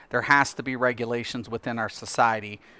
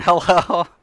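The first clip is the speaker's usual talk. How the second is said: high, louder laughing greeting; laughing hello; 6 semitones higher, 9 dB louder than usual. Real, not acted. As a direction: greet them again through a laugh. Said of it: "so hello again".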